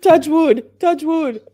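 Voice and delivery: loud, bright excited shouting. Real, not acted. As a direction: shout it with glee. Excited pleading after the win.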